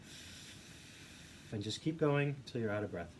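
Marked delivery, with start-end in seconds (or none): 0.0-1.5 soft unvoiced f breathed out steadily; quiet breathing demo, f sound; long f exhale. Shown as a demonstration. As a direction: push the breath out on f. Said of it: "exhale on f".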